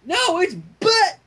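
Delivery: a high, slow mock shout that breaks off; a touch of a yell, cut short; starting to shout. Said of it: "Dash started to shout".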